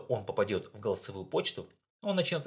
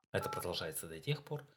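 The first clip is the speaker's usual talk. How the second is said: normal talking voice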